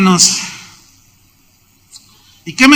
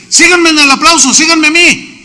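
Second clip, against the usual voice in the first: leader calling out; 8 semitones higher, 4 dB louder than usual. Retold or imitated.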